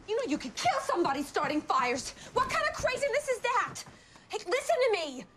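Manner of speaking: high-pitched, exasperated telling-off; alarmed, upset parent laying into them; angry scolding. Acted. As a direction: scold a child, voice climbing high. Mother scolds child over fires.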